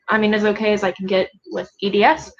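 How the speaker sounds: usual speaking voice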